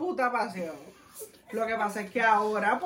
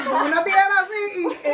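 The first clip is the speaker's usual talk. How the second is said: high, loud laugh while telling; laughing shout; 8 semitones higher, 4 dB louder than usual. Real, not acted. Son laughing loudly recalling the scissors tire change.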